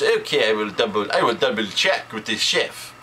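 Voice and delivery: imitated French waiter; French accent. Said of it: "the waiter in his French accent".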